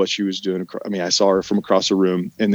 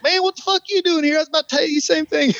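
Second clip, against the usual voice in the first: surprised shout; faster than usual. Retold or imitated. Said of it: "he's like".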